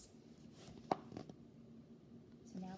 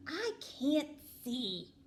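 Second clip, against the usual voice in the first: slow, higher, louder worried snail; little snail voice; 3 semitones higher, 5 dB louder, much slower than usual. A narrator's reading. Snail voice in dark.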